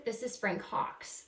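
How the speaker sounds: normal talking voice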